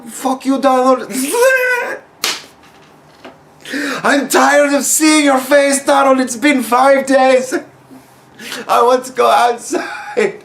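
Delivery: high, slow mock whine of a stuck maid; fed-up maid. Maid venting at Donald through the quarantine, high mock whine. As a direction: pitch it up and drag it out, sick of him. Maid voiced yelling at Trump.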